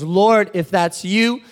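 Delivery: slow, loud plea to the Lord; loud, drawn-out challenge; Peter calling out. Acted. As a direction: call across the water, stretching each word. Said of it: "he's frustrated".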